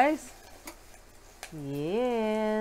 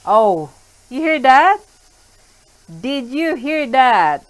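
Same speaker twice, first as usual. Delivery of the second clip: excited; 5 semitones higher, 14 dB louder than usual. Real, not acted. Excited at the sizzling pan.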